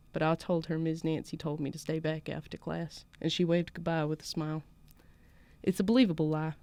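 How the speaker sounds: put-on measured story reading in a southern US accent. Podcast host reads her co-host's story aloud, southern accent. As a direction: narrate evenly with a Southern lilt. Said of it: "I didn't practice my southern accent".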